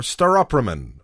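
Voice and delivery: Staropramen run together as "star-OP-ruh-men"; alternative pronunciation. Shown as a demonstration. Podcaster guessing again at his lager's name, alternative pronunciation. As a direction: say Staropramen with a short, weak "pruh" after "star-OP". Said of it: "How do you pronounce that?".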